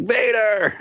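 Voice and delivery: put-on high child squeal of the name; toddler shouting Vader. Dad imitating his daughter spotting Darth Vader on screen, high squeal. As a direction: squeal it like an excited little girl. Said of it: "She'd be like".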